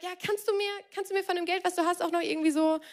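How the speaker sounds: high-pitched, breezy imposition; cheeky stranger. Acted. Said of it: "die Person sagt".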